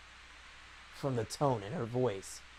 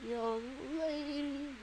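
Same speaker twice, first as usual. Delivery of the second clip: put-on old woman voice; 12 semitones higher, 5 dB quieter, slower than usual. Shown as a demonstration. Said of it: "I can't do an old woman".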